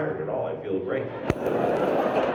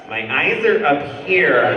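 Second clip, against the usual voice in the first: loud, high, slow indignant protest; hammed-up offence, stretched and raised; mock offended; 5 semitones higher, 11 dB louder, slower than usual. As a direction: call them out with exaggerated indignation. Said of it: "I get offended".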